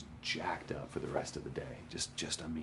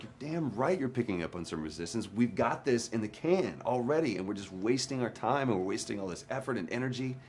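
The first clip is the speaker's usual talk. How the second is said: higher, louder, exasperated retort; retold anger; 7 semitones higher, 5 dB louder than usual. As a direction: push back hard at the director, fed up. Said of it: "I'm getting madder and madder".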